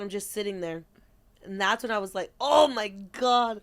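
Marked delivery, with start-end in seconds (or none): 2.4-3.6 drawn out shock. Retold or imitated. Cold plunge shock.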